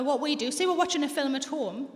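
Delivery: normal talking voice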